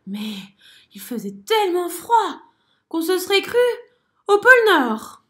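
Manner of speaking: de plus en plus fort et aigu; plainte. A narrator's reading. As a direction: se plaindre du froid. Complaint crescendo. (grenouille qui se plaint de plus en plus fort)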